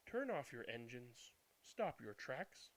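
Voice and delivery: plain reading voice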